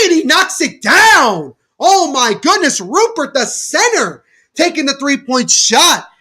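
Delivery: high, loud burst of surprise at the center's three; stunned, thrilled commentator; excited call. Acted. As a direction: yell it like the crowd just erupted. Center hits a deep three.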